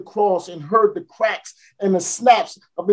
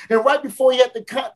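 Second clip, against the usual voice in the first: ordinary talk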